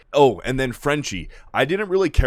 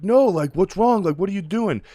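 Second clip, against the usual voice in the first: put-on pleading kid voice; 10 semitones higher, 3 dB louder than usual. Ryan begs Butcher.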